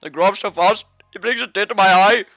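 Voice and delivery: high, loud mock-proud Indian accent; badly faked Indian accent. Friend pretending parental pride, in a loud fake Indian accent. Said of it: "fucking Indian man's voice instead".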